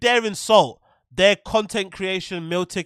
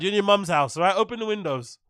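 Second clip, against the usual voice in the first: usual speaking voice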